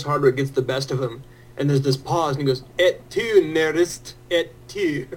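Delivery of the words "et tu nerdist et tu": hammed-up Scottish reproach, breaking toward a laugh; Craig Ferguson. Guest answers the host who got the best of him, mock-wounded Scottish accent. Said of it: "very Scottish and awesome".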